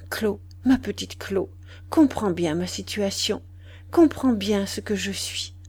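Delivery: plain reading voice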